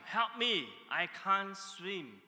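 loud, high acted cry for help; mock drowning man's shout, stretched out slow at the end. Friend fallen into the lake, shouting for rescue.

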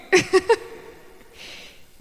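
a real airy laugh, high and light; high breathy laugh. Real, not acted. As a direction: laugh off the awkward handover. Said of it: "So this is great".

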